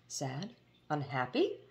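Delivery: plain reading voice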